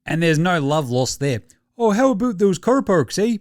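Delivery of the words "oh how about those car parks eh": higher, hammed-up Canadian accent. Canadian players going at each other, mock Canadian accent. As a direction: put on a cartoon Canadian accent. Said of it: "They've just gone full Canadian".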